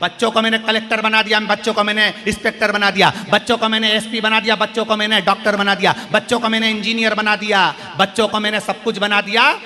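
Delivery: put-on fast proud list of what I gave the kids; quick boastful parent, lightly voiced; rattling off boasts. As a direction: reel off your achievements quickly like a proud parent. Parent boasting of giving children everything.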